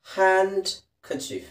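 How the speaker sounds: handkerchief drawn out very slowly as hankachif, silent d; handkerchief stretched very slowly, hankerchif; pron. Shown as a demonstration. Teacher drawing out handkerchief very slowly for students. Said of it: "handkerchief ಅಂತ ಹೇಳಿ ಸಾಕು".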